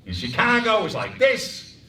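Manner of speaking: loud, high, nasal Chicago; Chicago voice over noise; brassy Chicago accent. Shown as a demonstration.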